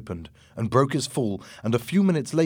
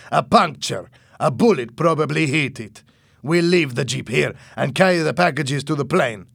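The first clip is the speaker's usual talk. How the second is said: louder, higher annoyed character voice; angry; 4 semitones higher, 5 dB louder than usual. A narrator's reading. Boss kicking the jeep's punctured wheel, angry. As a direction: raise pitch and volume in irritation. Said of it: "he said angrily".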